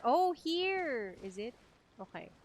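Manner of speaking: ordinary delivery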